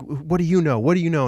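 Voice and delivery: loud, high outraged outburst; freaking out. Retold or imitated. Soldier freaks out at the insulting boy, enraged. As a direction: explode in retold fury at the challenge. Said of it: "freaks out".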